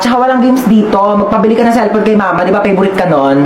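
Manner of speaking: loud refusing big sister; angry sibling. Acted. Older sibling refuses to lend her phone, loud and angry. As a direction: snap at the little sibling, keep the phone.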